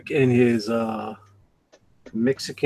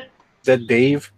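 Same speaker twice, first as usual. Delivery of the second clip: slower, spelled-out repeat of the question; slow clear repeat; 2 semitones lower, slower than usual. Real, not acted. Repeating the question slowly for a friend who missed it. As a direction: say it again slowly so he catches it.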